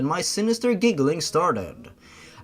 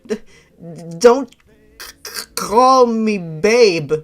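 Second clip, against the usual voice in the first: loud, high, slow stuttering protest; stammering protest; 5 semitones higher, 7 dB louder, slower than usual. Acted. Girl refusing Ben's pet name, loud stammering protest. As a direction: snap back through the stutter.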